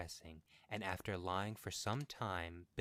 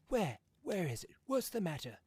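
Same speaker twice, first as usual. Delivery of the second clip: alarmed; 11 semitones higher, 6 dB louder than usual. Acted. Husband startled awake in alarm.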